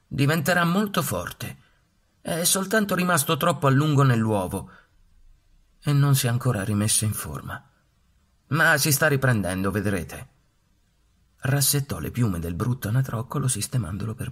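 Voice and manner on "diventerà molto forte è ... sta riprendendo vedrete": high, louder, firm mother duck; mamma anatra. A narrator's reading. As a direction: stand up for her duckling. Mamma duck defending duckling. (mamma anatra decisa, voce alta)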